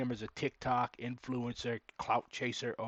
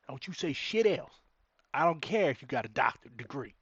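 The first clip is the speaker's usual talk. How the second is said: put-on horrible southern accent; 3 semitones higher, 6 dB louder than usual. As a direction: do a bad, bossy southern accent. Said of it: "he put on a southern accent".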